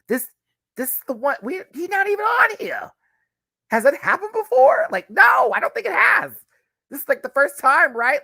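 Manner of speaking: very high, loud disbelieving exclaim; excited exclaiming. Real, not acted. Stunned fan shouting that Jay isn't on the album.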